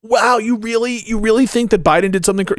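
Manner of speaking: high, sneering mock-incredulous voice; mocking disbelief. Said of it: "They're like".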